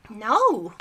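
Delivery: sudden loud shout of no. A narrator's reading. Roddy refusing the snake hunt, loud panicked shout. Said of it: "Roddy shouted".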